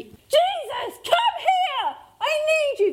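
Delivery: a beggar's desperate, raw call to a passing healer; desperate cry. Retold or imitated. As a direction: cry out for Jesus as if your life depends on it. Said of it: "That's a desperate cry".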